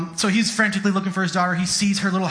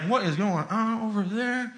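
higher, slow, hushed disbelief; shocked neighbor; 4 semitones higher, 4 dB quieter, slower than usual. Acted. Neighbors watching a father yell, mock shock. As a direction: gasp it as a scandalized onlooker. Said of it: "the neighbors are probably like".

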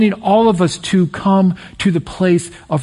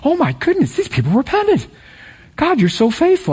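much higher, delighted gushing exclamation; pretend overjoyed; 9 semitones higher than usual. Jonah as he should have been, gushing with delight. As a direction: gush as if bursting with joy. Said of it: "jumping up and down and saying".

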